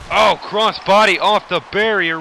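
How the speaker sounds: announcer thrilled by a high-flying move; excited call. Acted. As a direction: call the big move with a jolt. Big move during commentary.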